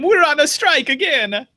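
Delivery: high, goofy Italian caricature; hammed-up Italian accent; mock Italian accent. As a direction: toss off a joke in a silly Italian accent.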